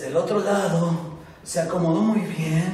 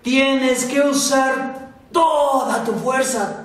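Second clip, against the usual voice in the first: shouting; 6 semitones higher, slower than usual. A narrator's reading. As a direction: hammer the command home. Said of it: "su maestro le gritó".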